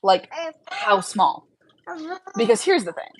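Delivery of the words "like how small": slow careful question. Real, not acted. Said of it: "I don't wanna sound rude".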